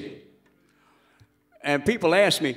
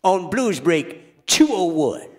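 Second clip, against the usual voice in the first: Louisiana bluesman voice. Acted. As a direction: announce it like an old blues DJ. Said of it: "I'm doing the voice of anybody from Louisiana".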